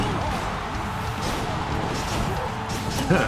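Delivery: breathy squeal of laughter; high squeaky laugh. Real, not acted. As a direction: squeal and giggle. Laughing at hand holding joke.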